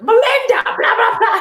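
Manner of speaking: high, loud mock phone shout; imitating mom shouting. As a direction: mimic mom yelling into the phone. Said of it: "she's shouting on the phone".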